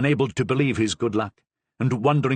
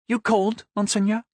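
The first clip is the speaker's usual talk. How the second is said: put-on lively young woman, higher, with a Languedoc accent; 11 semitones higher than usual. A narrator's reading. Madame Cavrois answers the Cardinal boldly, Languedoc accent. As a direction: bold Languedoc lilt, nose in the air. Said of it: "with a strong Languedoc accent".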